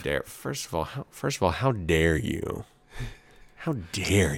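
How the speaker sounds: hammed-up wounded dignity; mock offended. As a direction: play deeply affronted, dragging out the words. Mock offense at friend's jab.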